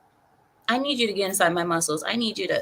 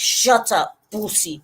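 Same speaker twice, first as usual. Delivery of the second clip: imitated Russian masseuse; 3 dB louder, slower than usual. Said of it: "she's like".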